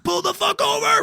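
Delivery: high yelled order; shouting. Retold or imitated. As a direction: scream at the driver to stop. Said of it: "shaking me, like".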